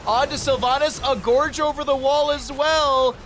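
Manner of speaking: excited. Real, not acted. Caster hype over big play.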